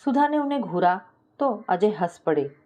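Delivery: ordinary delivery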